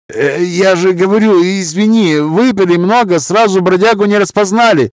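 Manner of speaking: high, anxious placating voice. A narrator's reading. Peacemaker apologizing to scarred man, high and placating.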